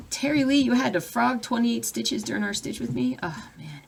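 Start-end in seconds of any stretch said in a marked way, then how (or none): none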